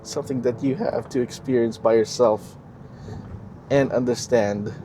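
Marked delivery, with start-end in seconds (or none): none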